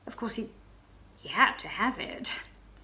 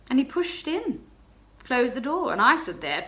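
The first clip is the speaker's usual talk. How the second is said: usual speaking voice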